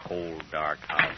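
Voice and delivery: low, subdued grumble to himself; glum muttering. Acted. Man coming home to a dark house, muttering glumly. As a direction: mutter dejectedly, pitch sunk low.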